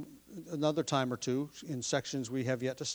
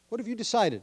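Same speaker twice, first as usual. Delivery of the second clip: higher pressing question; 7 semitones higher than usual. Shown as a demonstration. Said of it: "That's how I worded it".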